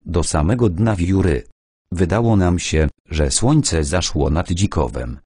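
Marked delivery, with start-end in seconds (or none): none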